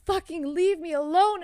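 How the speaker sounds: yelling at the ghost to leave; retold yell. Screaming at the thing in her kitchen to get out.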